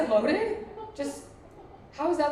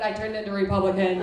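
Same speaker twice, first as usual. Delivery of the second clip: usual speaking voice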